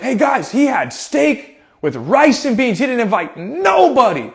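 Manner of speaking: high, slow, hammed-up calling out; playful scandalized broadcast to the group; mock public announcement. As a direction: announce it in pretend outrage. Playfully announcing child's dinner to others.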